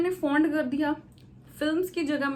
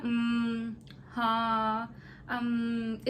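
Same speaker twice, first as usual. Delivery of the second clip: drawn-out hesitant umm and haan; hesitation fillers; 2 semitones lower, 4 dB quieter, much slower than usual. Shown as a demonstration. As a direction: demo a stalling student's fillers. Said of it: "possess किस तरह के होते हैं?".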